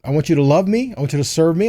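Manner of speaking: normal talking voice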